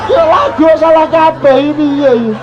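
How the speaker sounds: high shouted complaint; child shouting. Retold or imitated. Son shouting that all songs are wrong, high. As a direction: yell it like an upset kid.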